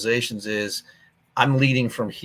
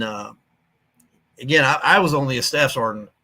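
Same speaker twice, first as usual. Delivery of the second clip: usual speaking voice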